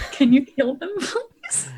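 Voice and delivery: pleading. Pretend. Mock plea to the healer.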